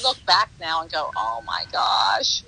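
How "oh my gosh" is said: very slow, low, stunned under the breath. Retold or imitated. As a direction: let it sink in, slowly and low. Stunned when he hugged her.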